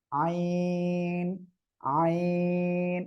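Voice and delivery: আইন drawn out slowly from a pressed throat; correct throat-pressed ain. Shown as a demonstration. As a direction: squeeze the throat and hold আইন long. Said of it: "একটু টেনে পড়তে হবে এমন".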